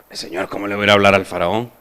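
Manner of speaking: low, soft, hurried protest; meek objection. Acted. Moses doubting he can speak to Pharaoh, meek objection. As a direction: object under your breath, quickly.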